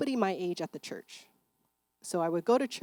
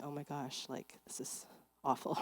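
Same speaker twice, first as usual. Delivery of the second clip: whispered inner thought; 4 semitones lower, 10 dB quieter than usual. Retold or imitated. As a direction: breathe it out under the breath. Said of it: "I remember thinking".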